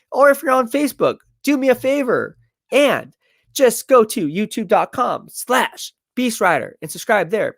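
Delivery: high, energetic sponsor pitch; hyped promo read. Acted. Hyped subscribe and giveaway pitch.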